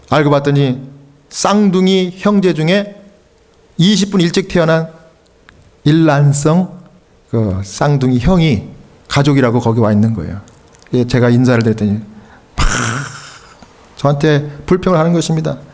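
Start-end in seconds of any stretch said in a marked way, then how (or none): none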